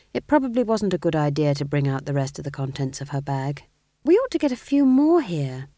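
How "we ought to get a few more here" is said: high, raised sad appeal. A narrator's reading. Young witch wants more members in the coven, sadly. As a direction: lament it in a high, louder voice. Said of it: "she said sadly".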